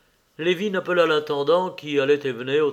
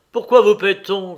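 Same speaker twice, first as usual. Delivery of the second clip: loud, higher-pitched outburst; exasperated master's shout; angry cry; 5 semitones higher, 9 dB louder than usual. A narrator's reading. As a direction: shout the question at an idle employee. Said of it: "cria-t-il".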